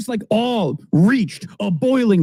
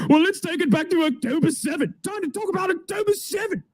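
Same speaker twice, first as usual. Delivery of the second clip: mock shouted outburst; 7 semitones higher, 4 dB quieter, faster than usual. Mimicking Piers pouncing on October seventh, a hammed-up yell. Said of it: "he immediately goes".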